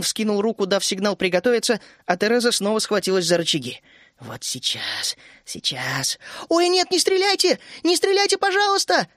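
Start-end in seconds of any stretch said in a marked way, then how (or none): 4.2-6.2 low, soft, near-whisper; hushed anticipation. A narrator's reading. Тереза lining up the shot at the dragon, hushed anticipation. Тереза ждёт момента для выстрела. 6.5-9.1 high, thin pleading cry. A narrator's reading. Вишня begging the gunners to hold fire, thin cry. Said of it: "тонко вскрикнула Вишня".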